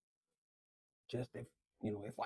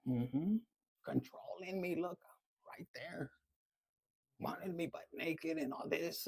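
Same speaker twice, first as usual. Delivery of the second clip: ordinary delivery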